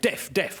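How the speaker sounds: "Deaf, deaf" with an F sound ending each word in place of TH; dialect TH as F. Retold or imitated. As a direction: say death as "Deaf", twice, ending on F. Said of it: "or in their dialect".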